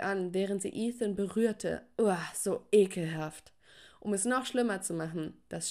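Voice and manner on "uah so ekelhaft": disgusted. Acted. Rival flirts with her crush.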